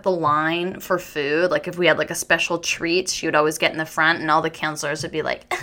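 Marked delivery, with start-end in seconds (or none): none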